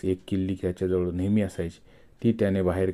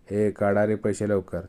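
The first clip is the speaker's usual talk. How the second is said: ordinary delivery, nothing marked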